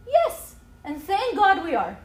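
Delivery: high, loud, emphatic yes; excited. Real, not acted. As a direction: cheer the answer out. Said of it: "like, excited. I'm sure you can tell".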